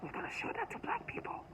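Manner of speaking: put-on very high, soft whispered child voice; imitating a whispering child voice; child's whisper. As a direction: hiss it like a sly little girl. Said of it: "but she's like".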